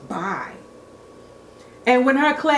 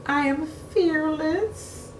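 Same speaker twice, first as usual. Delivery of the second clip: much softer, higher, slower, with a rising end; unconvincing fearless claim; 6 semitones higher, 6 dB quieter than usual. A narrator's reading. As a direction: say it brave but doubting it. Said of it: "tried to convince herself".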